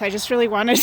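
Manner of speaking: usual speaking voice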